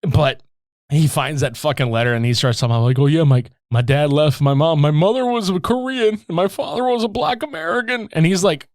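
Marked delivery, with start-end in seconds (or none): 3.0-8.1 rising into a higher, overwrought plea; mock crying. Orphan explaining his parents after finding the letter, mock crying. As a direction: imitate an actor straining for tears. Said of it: "giving his all, crying".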